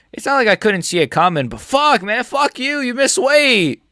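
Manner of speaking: high, loud frustrated yelling; real angry outburst. Real, not acted. Streamer raging at the weight-missing fighter, loud. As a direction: vent the rage at the screen. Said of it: "I'm actually angry".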